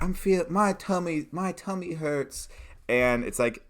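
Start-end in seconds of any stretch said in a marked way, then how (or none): 0.0-2.5 put-on high-pitched child with a tummy ache; complaining little kid. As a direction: whine it up high as a poorly kid. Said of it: "this kid's like".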